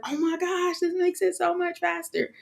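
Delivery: high, breathy sudden delight; excited realization. Retold or imitated. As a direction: gush with surprise. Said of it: "I was like".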